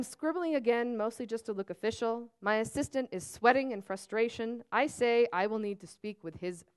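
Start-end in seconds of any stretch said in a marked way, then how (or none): none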